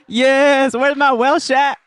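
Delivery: high, loud, laughing through the words. Real, not acted. Joking about her Welsh roots, laughing. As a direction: giggle out the question. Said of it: "laughing at own joke".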